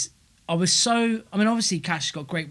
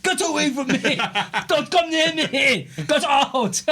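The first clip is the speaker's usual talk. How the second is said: higher, laughing mock outrage; mock angry; 8 semitones higher, slower than usual. Retelling his mate's gym outburst, laughing mock anger. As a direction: ham up his disgusted gym mate. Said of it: "He was so angry".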